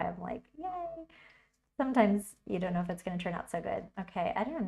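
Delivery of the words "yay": little cheer. Real, not acted. Happy cheer.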